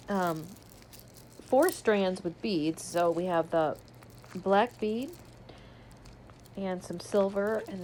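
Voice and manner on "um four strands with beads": usual speaking voice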